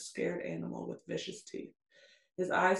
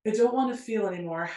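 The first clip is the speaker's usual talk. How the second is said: loud, higher, rushed anguished plea, acted; choked out; 4 semitones higher, 10 dB louder, faster than usual. A narrator's reading. Narrator voicing her breaking down before him, choked-out plea. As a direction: blurt it out quickly, as if overwhelmed. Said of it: "I choke out".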